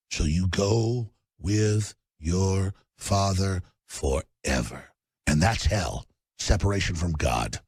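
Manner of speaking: grave sermon tone, pitched low; ominous preaching. Acted. Ominous sermon about the devil.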